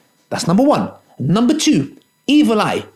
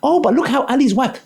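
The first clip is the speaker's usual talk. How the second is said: mock envious viewer; 7 semitones higher than usual. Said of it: "brothers might watch".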